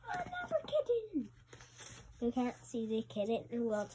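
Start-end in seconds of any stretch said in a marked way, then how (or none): 0.1-1.3 high, fast squeak; squeaky high voice. Shown as a demonstration. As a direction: pitch it way up, playful. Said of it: "repeated kitten takes".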